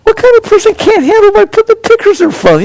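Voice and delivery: high, loud incredulous no; incredulous outburst. Acted. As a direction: scoff at the idea, way up high. Mocking someone who can't cope.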